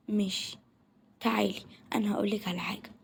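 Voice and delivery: whispered, leaning in to tell a secret; secretive whisper. Acted. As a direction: hush it like a confidant. Sharing a secret tip. (همس سري)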